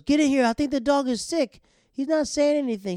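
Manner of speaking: high, loud frantic call for help; mock panic. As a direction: act out an alarmed shout. Acting out panicked faked dog emergency.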